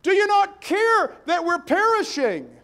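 high, loud panicked cry for help; terrified disciples screaming. Retold or imitated. Disciples in a sinking boat scream to sleeping Jesus, high and loud. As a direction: scream it like men about to drown in the storm. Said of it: "And they screamed".

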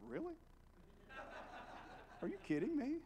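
high-pitched incredulous questions; mock amazement, pitch leaping up; retold disbelief. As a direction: act out stunned disbelief at a compliment. Retold astonishment at professor's praise.

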